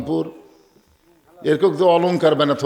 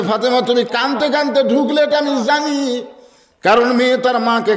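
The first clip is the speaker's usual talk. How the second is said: high-pitched sermon chant; impassioned preaching; 10 semitones higher, 4 dB louder than usual. Acted. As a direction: carry the quote on a raised, ringing preacher's voice. (উঁচু সুরে আবেগভরা ওয়াজ)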